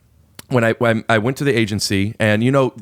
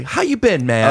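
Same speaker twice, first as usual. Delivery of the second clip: high-pitched, insistent hello; over-connected greeting; 7 semitones higher than usual. Retold or imitated. Agent greeting the client afresh in each room, over-connected. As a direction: imitate someone who must greet once more. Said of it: "He felt the need to say".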